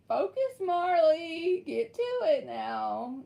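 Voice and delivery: high, drawn-out order to oneself; mock self-scolding. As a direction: play at pulling yourself together. Mock-scolds self to stop laughing.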